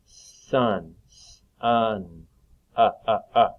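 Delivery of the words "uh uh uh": "Uh, uh, uh" as three clipped short U sounds; teacher models the short U for kids, punched out; short U repeated. Shown as a demonstration. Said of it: "That's got the short U sound".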